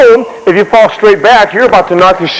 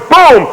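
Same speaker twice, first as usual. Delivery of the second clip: high, sudden "boom"; storyteller's exploding boom; imitated gunshot; 8 semitones higher than usual. Imitates second shot at horse.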